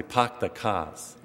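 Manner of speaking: dropped-R Boston accent, drawn out slowly; slow Boston "pahk the cahs". Shown as a demonstration. Priest showing the congregation how Bostonians say it, Boston accent. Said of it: "In Boston, they".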